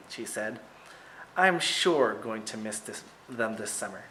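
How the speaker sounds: ordinary delivery, nothing marked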